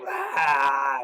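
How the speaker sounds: loud sudden mock scream; imitated zombie scream. Zombie suddenly attacks Beth, loud imitated scream.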